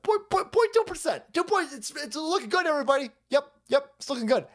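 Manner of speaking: squeaky put-on voice of a cheerful economist; high mock economist voice. Economist voiced high, brushing off inflation. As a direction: pipe up high like a chirpy economist. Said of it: "Mark Zandi".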